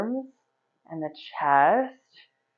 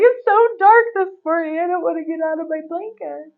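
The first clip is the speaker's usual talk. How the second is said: higher, whining about the dark; childlike complaint from under the blanket; mock whiny self; 10 semitones higher, 6 dB louder than usual. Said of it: "So I was like".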